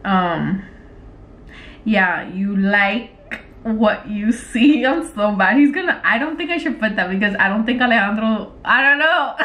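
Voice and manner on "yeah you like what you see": slow, spaced-out words; typing aloud. Real, not acted. Typing a flirty line to him, slow dictation. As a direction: draw it out as you type.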